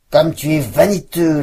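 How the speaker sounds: loud, spiteful snarl. Acted. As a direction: sneer at the vain birch. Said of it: "d'un ton hargneux".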